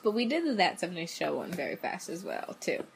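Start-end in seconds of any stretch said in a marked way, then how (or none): none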